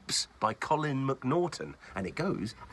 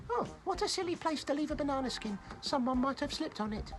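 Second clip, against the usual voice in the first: high, fast cheerful voice; innocent piglet. Acted. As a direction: chirp it like a naive little pig. Preston avoids banana trick.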